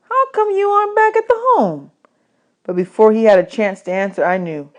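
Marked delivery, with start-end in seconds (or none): 0.1-1.9 put-on high boy voice; a light kid's question; Bud asking. A narrator's reading. Bud asking Bugs why he left the home, high boyish voice.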